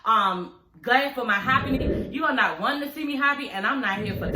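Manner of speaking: usual speaking voice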